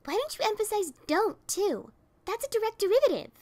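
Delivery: high, loud biting retort; high fast sarcastic jab; sarcastic anger. A narrator's reading. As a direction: snap back sarcastically.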